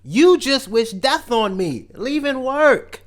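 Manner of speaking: loud, high-pitched mock indignation; hammed-up scolding, stretching out the final accusations; mock outrage. Comic telling off coworkers who wished death on him, loud high outrage.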